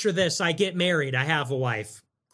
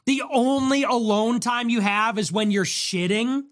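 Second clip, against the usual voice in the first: normal talking voice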